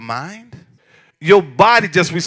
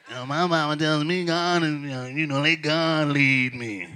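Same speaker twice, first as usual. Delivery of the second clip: a hint of a mumbling, low excuse-maker; lightly mock the reluctant member; whiny churchgoer voice; 3 semitones lower, 8 dB quieter than usual. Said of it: "that's the voice they use".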